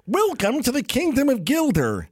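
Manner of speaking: high, excited morning-show host; bright radio opener; high mock radio DJ; Vizzini radio host. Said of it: "they would go".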